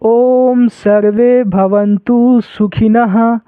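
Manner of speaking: सुखिनः ending with a clear breathy ह; slow recitation, final h. Shown as a demonstration. Teacher showing the visarga sound in the mantra. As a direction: chant slowly, sounding the ह at the end of सुखिनः. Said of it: "यानी ह पढ़ेंगे".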